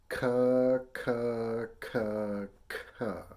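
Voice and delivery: hard K from the back of the tongue on each "Ka"; K-tongue syllables. Shown as a demonstration. Teacher leading students to say it along, K-tongued "Ka". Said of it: "Say that with me".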